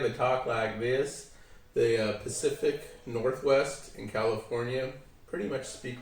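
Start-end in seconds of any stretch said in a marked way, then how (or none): 0.0-1.3 slow, drawn-out Southern accent. Shown as a demonstration. Showing the interviewer how the South talks, Southern accent. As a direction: draw the words out like a Southerner. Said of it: "In the South, as I said".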